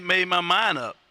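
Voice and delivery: ordinary delivery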